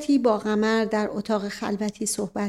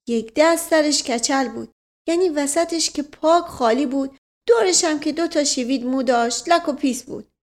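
rambling servant, earnest; 4 semitones higher than usual. Acted. Servant reporting on his bald townsman, earnest and raised in pitch. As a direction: play an earnest storytelling manservant. Voices servant Mash Qasem.

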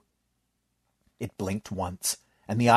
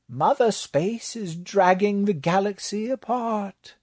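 higher, slow voice for the librarian; slow and raised, telling a grave fact; 11 semitones higher than usual. Acted. Librarian warning Bailey about Mother Space, slow and raised. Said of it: "the librarian said".

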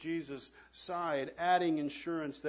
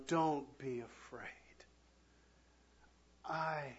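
tender reassurance; 3 semitones lower, 8 dB quieter, slower than usual. Acted. Jesus comforting John in prison.

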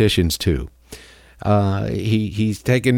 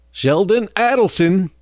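gleeful; 10 semitones higher, slower than usual. Real, not acted. Host gleeful at billionaire's death.

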